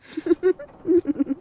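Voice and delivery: high satisfied chuckle; pleased laugh. Acted. Vampire to her victim, a satisfied laugh. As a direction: laugh with sated delight. Vampire relishing first blood.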